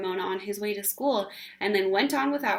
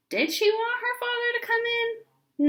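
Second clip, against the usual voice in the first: much higher, mocking the idea; indignant inner question; 13 semitones higher than usual. A narrator's reading. Ramona asking herself in disbelief, higher. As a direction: scoff at the very thought. Narrator voices Ramona's thought.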